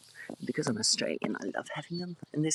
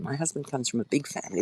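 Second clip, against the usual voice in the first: ordinary delivery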